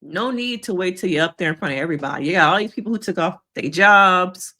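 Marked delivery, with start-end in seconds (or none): none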